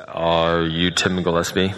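booming, slow, very deep professor; incredibly low voice. Acted. Professor at the door asking for Tim, incredibly low voice. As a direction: drop right down, loud and drawn out. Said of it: "in this incredibly low vo-, low voice".

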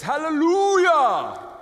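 high, loud, slow preacher's hallelujah. Retold or imitated. Pastor imitates Eddie's booming preacher hallelujah. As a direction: belt it out slow, full gospel preacher. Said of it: "Eddie has a preacher's voice".

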